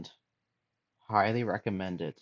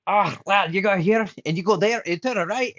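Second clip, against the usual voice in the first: mock thick Scottish accent, much higher and louder; imitating a helpful Scot giving directions in a broad Scottish accent, pitched up and loud; 10 semitones higher, 9 dB louder than usual. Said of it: "they speak with such a thick accent".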